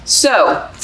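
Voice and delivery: ordinary delivery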